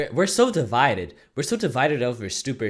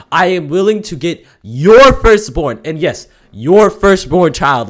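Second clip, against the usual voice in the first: slow, louder threat; mock menace; 4 semitones higher, 10 dB louder, slower than usual. Fan threatening the viewer, slow and louder.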